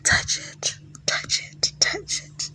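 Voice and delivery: hushed insistent whisper; softly insisting whisper; repeated whispered command. Retold or imitated. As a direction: coax her in a whisper, over and over.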